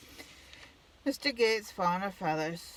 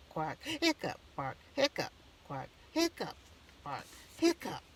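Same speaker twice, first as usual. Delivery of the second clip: quacks and barks with hiccups. Acted. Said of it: "One of the hiccup things".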